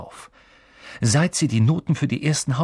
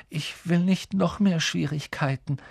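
put-on higher, mumbled boy's voice; mumbling Neville; 7 semitones higher than usual. A narrator's reading. Neville refuses to go, a higher, mumbled boy's voice. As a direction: mumble it, not wanting trouble. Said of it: "murmelte er".

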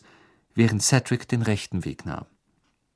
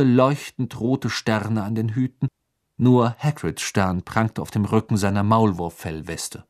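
plain reading voice

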